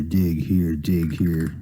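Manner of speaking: usual speaking voice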